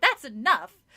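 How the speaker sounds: loud scolding shout; mother shouting to stop it; angry shout. A narrator's reading. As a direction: cut it off with a loud yell. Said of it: "Missus Powell shouted".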